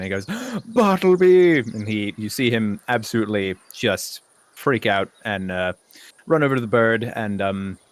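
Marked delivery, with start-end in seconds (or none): 0.3-1.6 gasp, then a high, loud cry of delight; thrilled, long-held high call; childlike excitement. Acted. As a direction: burst out overjoyed at an old friend. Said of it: "with childlike recognition".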